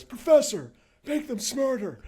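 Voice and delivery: high, loud retold command; commanding order. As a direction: give the professor his orders, firmly. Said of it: "he looks at me and he goes".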